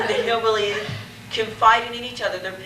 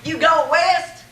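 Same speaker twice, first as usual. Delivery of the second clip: loud hammy hillbilly greeting; hammed-up rural drawl, calling out; 6 semitones higher, 5 dB louder, much slower than usual.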